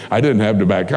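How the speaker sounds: ordinary talk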